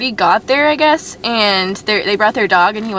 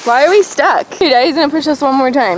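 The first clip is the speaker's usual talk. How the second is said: higher, lively sled ride chatter; sledding downhill; 5 semitones higher than usual. Real, not acted. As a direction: narrate the ride, excited.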